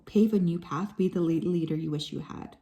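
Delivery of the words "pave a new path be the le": ordinary talk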